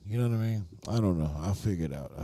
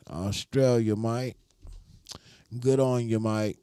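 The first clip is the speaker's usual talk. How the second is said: higher-pitched mock Aussie; Australian accent; 3 semitones higher than usual. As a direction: play at being an Australian mate. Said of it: "Hit him with that Australian talk".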